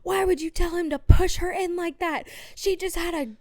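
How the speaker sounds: high, loud furious mom yelling at the sisters; mom screaming. Retold or imitated. Mom screaming at sisters over the push, furious yell. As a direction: tear into them like an enraged mother. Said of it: "starts screaming at my sisters".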